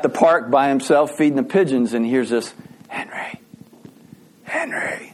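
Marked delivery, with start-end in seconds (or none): 2.9-5.1 soft, breathy whisper from beyond; hushed, eerie whisper of a name; ghostly whispered call. Acted. Ghostly voice calling a friend.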